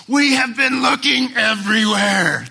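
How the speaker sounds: high, loud frantic cry; Mary's loud cry. Retold or imitated. Mary calling out to her son, high and loud. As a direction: cry it out across the temple square. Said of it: "everybody in the temple square probably heard".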